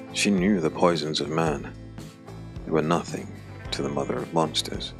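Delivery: ordinary talk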